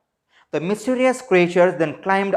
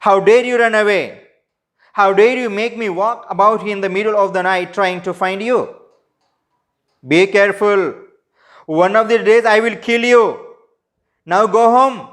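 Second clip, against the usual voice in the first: loud, higher-pitched scolding and threatening; storyteller's raised angry shout, threatening; angry scream; 4 semitones higher, 4 dB louder than usual. A narrator's reading. Angry voice scolding the tiger for running away, shouting angrily. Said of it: "Voice screamed angrily".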